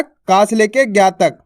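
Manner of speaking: slow, deliberate repeat of का से लेके ज्ञा तक. Shown as a demonstration. Teacher repeats the range for students, slowly. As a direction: spell it out slowly so students catch it. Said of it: "कहाँ तक?".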